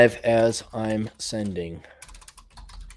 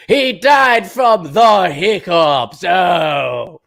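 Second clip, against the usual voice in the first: mock solemn announcement; 3 semitones higher, 3 dB louder, much slower than usual.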